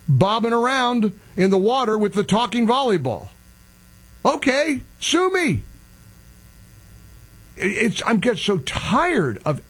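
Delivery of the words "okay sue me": defiant. Real, not acted. Defiant rant.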